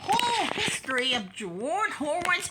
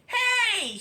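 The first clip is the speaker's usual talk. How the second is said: shout; 9 semitones higher, 4 dB louder than usual. Acted. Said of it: "shouted a fan".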